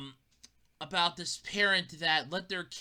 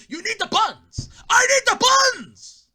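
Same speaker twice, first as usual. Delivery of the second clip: high, loud whiny yell for the buns; whiny shout; 11 dB louder than usual. Acted. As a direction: demand it like a spoiled kid, full volume. Whining demand for buns.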